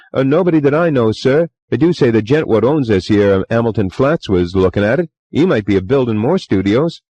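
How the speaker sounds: put-on chatty young bellboy, eager to please; Cockney bellboy. A narrator's reading. Bellboy Thomas voiced.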